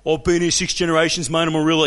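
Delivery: loud, scornful bogan imitation; dumb bogan. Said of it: "the stupidity of some dumb bogan".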